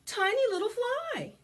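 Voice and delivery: ordinary delivery, nothing marked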